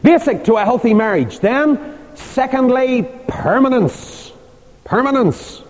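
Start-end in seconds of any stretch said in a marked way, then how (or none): 3.3-5.6 permanence drawn out slowly and higher; emphatic repetition. Shown as a demonstration. Preacher stressing permanence as point two. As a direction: lean on permanence, say it twice. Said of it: "repeated key word for emphasis".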